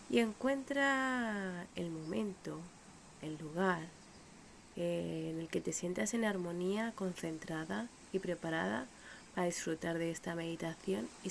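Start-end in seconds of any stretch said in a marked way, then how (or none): none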